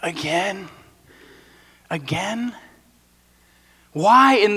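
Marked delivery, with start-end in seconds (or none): 0.0-2.5 softer, exasperated repeat; weary groan. Retold or imitated. A tired Israelite groaning at yet another detour, soft and weary. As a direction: sigh it out as worn-out travelers. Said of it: "you're just going".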